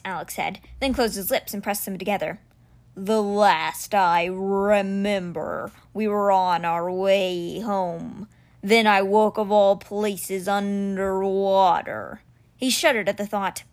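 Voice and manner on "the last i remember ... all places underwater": very slow, stretched-out big cat voice; stone cheetah, recollecting. Acted. Stone cheetah tells the boy of waking underwater, slow creature voice. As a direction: drag each word out ponderously.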